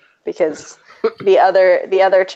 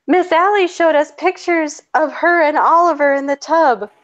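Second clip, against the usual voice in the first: high-pitched imitation of a little kid; mock small child; 9 semitones higher than usual. Two-year-old reporting to their mother, imitated high child voice. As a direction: play a toddler innocently telling on the teacher. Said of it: "one of my children went home".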